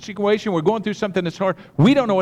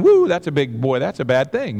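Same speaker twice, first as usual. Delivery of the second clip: usual speaking voice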